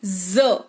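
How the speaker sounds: "ज" said with a buzzing z, not j, voice dropping low; z sound. Shown as a demonstration. Teacher shows students the nuqta sound, buzzing z. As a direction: give "ज" a clear zz like English zed. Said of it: "ज का उच्चारण जो है वो जे नहीं जेड".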